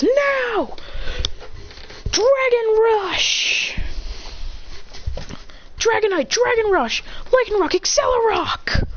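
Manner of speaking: high, stretched-out battle calls; attack commands. Acted. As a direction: sing out each move high and long. Trainer shouts attack commands.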